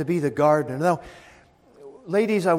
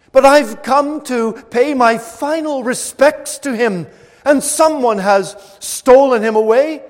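loud and higher, rising anguished outcry; Mary's anguish; 5 semitones higher, 9 dB louder than usual. Acted. Mary at the empty tomb, crying out in anguish. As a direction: cry out her grief and outrage, building to the end.

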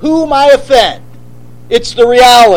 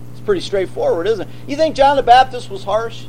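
ordinary talk, nothing marked

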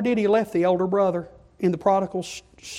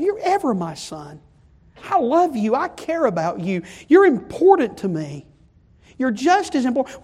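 pleading father; 6 semitones higher than usual. Acted. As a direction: gently beg a sulking son to come in. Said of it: "he's gonna plead with him".

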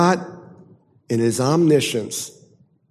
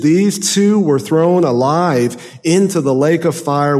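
loud, ringing preaching of the verse; forceful scripture reading; 3 semitones higher, 5 dB louder than usual. Acted. Preacher thundering the verse at his congregation, loud and ringing. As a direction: proclaim it like a judgment.

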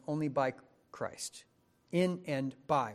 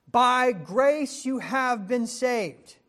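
slow, loud, higher, each word weighed; slow proclamation; 10 semitones higher, 11 dB louder, much slower than usual. Acted. Preacher proclaiming the key verse slowly at full force. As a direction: proclaim it like a verdict.

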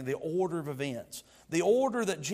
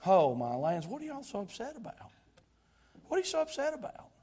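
God wondering; 7 semitones higher than usual. Acted. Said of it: "he thinks".

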